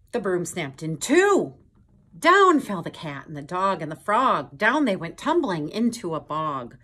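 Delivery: dramatic telling. A narrator's reading. Reading the broom snapping to children, loud and dramatic. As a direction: boom out the crash.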